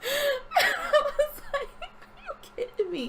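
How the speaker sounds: high, squeaky laugh through the words; laughing disbelief. Real, not acted. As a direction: crack up while saying it, very high. Laughs at the bad disguise.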